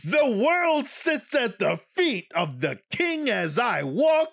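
loud, slow, grand Shakespearean boom; hammy stage actor. Retold or imitated. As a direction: ham it up like a stage king. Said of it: "Shakespearean actor Lawrence Fishburne".

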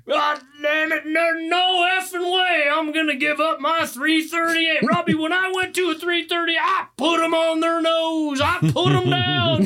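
put-on high, loud, slow angry old man yelling; an elderly father shouting at his son over his rifle, furious; fired-up shout. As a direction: bellow it like a riled-up old dad defending his rifle. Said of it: "he was getting fired up".

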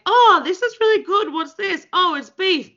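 put-on childish delight. Acting out childhood dinner talk.

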